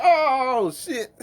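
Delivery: high, loud startled yelp with a laugh; high laughing mock yelp. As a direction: jump like you got tapped on the shoulder. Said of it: "You be like".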